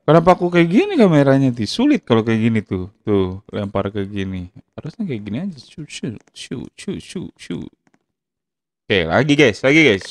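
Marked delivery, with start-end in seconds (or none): 5.6-7.6 whispered shoo shoo; soft shooing. Real, not acted. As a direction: shoo them away softly. Quietly shooing in game. (mengusir pelan)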